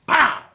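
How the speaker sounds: loud high pow; punch sound effect. Retold or imitated. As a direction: shout the punch noise.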